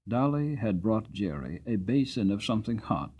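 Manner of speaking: ordinary delivery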